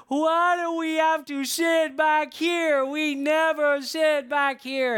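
small daughter complaining to Mom on the plane, mock crying whine; whiny little girl. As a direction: imitate a four-year-old wailing about her seat. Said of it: "Jennifer starts crying, and she's like".

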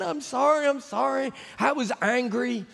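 high, timid pleading; apologetic guide pleading with the boss; pleading Carlos. Retold or imitated. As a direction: beg him not to be angry.

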